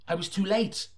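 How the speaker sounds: high, sudden dismay; the villain slipping away. Acted. Detective watching the killer fly off, high dismay.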